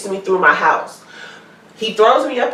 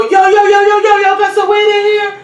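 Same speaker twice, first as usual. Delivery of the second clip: high, shouted yo yo yo at the door; 12 semitones higher, 5 dB louder than usual. Retold or imitated. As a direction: bang on the door like a buddy looking for weed.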